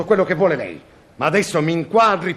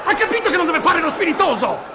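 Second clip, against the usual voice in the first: angry outburst; 10 semitones higher than usual. Acted. Director furiously scolding the cameraman for joking. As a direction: snap at the joker. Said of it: "stia calmo".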